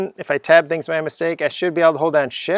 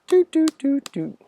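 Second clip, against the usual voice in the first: playful doots; 12 semitones higher than usual. Pretend. Sound effects while editing code.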